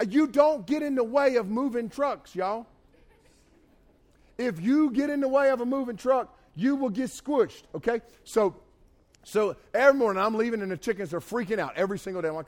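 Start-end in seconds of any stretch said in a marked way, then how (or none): 0.1-8.0 high, loud, indignant warning; raised-voice comic scolding; emphatic mock lecture. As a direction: lay down the law to the chickens. Mock lecture about squished chickens.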